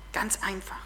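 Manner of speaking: soft whisper to the listeners; whispered aside. Real, not acted. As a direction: let it drop to a whisper. Nachsatz des Predigers.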